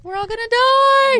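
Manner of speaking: very high, loud comic wail; mock terror. Co-host joking about the prophecy, loud mock-panicked wail. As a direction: shriek it like hammed-up doom.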